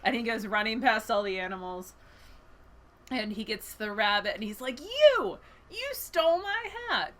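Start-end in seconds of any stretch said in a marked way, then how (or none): 4.8-7.1 high, hammed-up storybook outrage; outraged accusation. Bear confronting the rabbit who stole its hat, outraged. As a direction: accuse the thief in a high cry.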